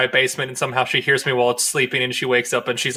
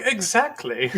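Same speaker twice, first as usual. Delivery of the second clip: high soft mock Abe; lightly voiced quiet fish-man reply; playful impression of Abe; Abe's voice; 10 semitones higher, 4 dB quieter, slower than usual. Abe voiced in retold movie scene.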